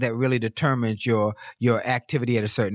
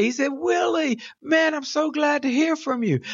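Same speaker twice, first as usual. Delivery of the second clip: put-on excited old friend; 13 semitones higher than usual. Retold Wally's excited answer.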